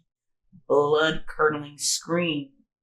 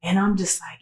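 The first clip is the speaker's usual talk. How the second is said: ordinary talk, nothing marked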